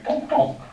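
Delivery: ping it out up high like a quick bounce; playful high-pitched bounce sound; sound-wave bounce noise. Shown as a demonstration. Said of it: "Let's just do one over here".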